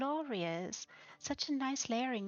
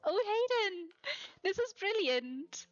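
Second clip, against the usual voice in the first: much higher and louder, then slowing; overjoyed, gushing praise; thrilled gushing; 6 semitones higher, 4 dB louder, slower than usual. Real, not acted. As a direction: swoon over the build with real delight. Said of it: "I'm having too much fun already".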